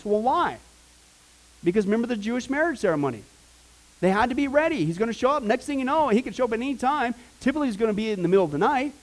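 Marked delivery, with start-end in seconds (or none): none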